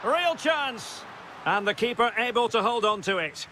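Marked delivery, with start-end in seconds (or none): 0.0-1.0 high, sudden burst of excitement; urgent commentator's exclamation; excited call. Real, not acted. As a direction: jump up in pitch as the chance opens. Commentator sees a scoring chance.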